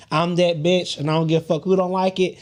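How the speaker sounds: usual speaking voice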